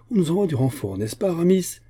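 high, eager voice rising to a question; hopeful excitement; elated. A narrator's reading. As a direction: share good news excitedly. Porthos rejoicing at expected reinforcements.

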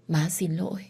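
breathless whisper of sorry; whispered apology. Acted. Mother whispers sorry to the child she abandoned.

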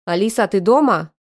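loud call from the other room; man shouting from the hallway. Acted. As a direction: call out loud, as if across the flat. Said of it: "Крик Медведева прозвучал с коридора".

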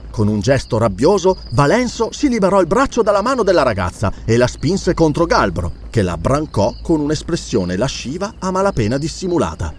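plain reading voice

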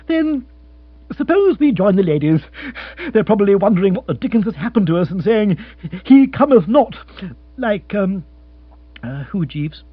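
chirpy lord offering comfort; young lord voice. Acted. Lord chattering.

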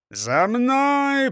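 high, drawn-out order; rallying shout of command. A narrator's reading. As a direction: call the troops to follow. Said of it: "приказал браншеф".

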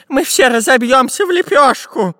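high-pitched panicked exclamation; desperate cry. A narrator's reading. Professor fearing the fall, crying desperately. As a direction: cry doom in despair. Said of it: "отчаянно закричал профессор Пыхтелкин".

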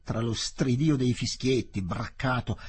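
usual speaking voice

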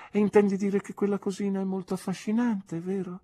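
an old aunt gently mocking; skeptical aunt. Aunt questions nephew about his sweetheart, arch aunt voice.

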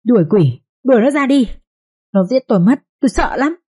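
rising high, panicked begging; terrified pleading. A narrator's reading. Mad mistress begs the nanny to drive out the demon, terrified. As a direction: beg in terror to chase the demon out. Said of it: "sợ hãi bà nói".

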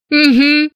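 high sniffly hum of agreement; tearful mumble. Acted. Heroine hums yes to the man, sniffling. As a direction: hum a yes through the tears. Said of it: "промычала, шмыгнув носом".

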